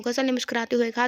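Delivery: plain reading voice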